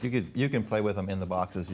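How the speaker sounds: ordinary talk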